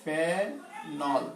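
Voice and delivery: Phenol drawn out softly in two long syllables; slow dictation. Shown as a demonstration. Teacher sounding out the chemical name for note-takers, slow dictation. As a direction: stretch phenol right out, syllable by syllable.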